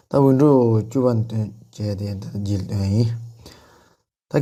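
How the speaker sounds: normal talking voice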